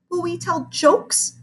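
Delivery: put-on high, slow kid question; child voice. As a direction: ask hopefully like a kid. Hopeful child asks.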